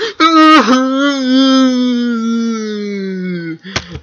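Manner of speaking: long high laugh; loud laugh. Real, not acted. Laughing at the TV scene, a long loud laugh. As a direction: burst out laughing.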